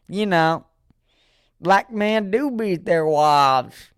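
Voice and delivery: high, drawn-out knowing pronouncement. Retold or imitated. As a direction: mimic someone smugly stating it as fact. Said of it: "They'll give that look too while they say it".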